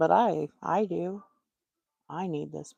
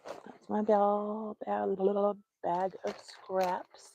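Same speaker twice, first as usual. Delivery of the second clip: slow listing; much slower than usual. Real, not acted. Listing items slowly.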